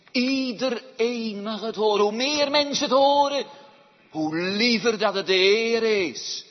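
loud, high, urgent proclamation; urgent preaching. Real, not acted. Preacher urges that God calls everyone, preaching loudly. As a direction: sound the alarm from the pulpit.

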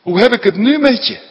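high, loud cry of disbelief; bewildered outcry. Acted. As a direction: throw it out in shock. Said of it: "roept hij verbijsterd uit".

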